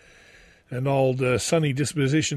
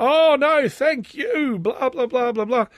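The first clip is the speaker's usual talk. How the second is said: much higher, loud falsetto gush; hammed-up high celebrity voice trailing into blah blah; mock gushing celebrity; 10 semitones higher, 5 dB louder, slower than usual. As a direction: squeal out the star's modest thanks.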